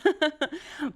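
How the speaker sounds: high, amused laugh; real high-pitched laughter. Real, not acted. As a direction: burst out in a high giggle. Laughs over many contenders.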